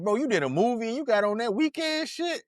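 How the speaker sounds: high-pitched incredulous teasing; mocking disbelief. Classmates teasing the child actor about his clothes, in mock disbelief. Schoolmates mocking him, retold.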